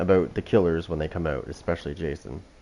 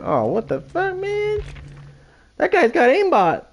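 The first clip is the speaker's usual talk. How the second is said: whiny accusing player. Acted. Killer mimics the player he just beat, mock high whine. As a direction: imitate a sore loser whining in a squeaky high voice. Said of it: "He's probably like".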